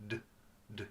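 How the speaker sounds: "d" clipped to a bare consonant, no vowel; short hard D. Shown as a demonstration. Teacher showing the right sound, very short D. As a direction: tap "d" quickly and softly. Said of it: "It's a very short sound".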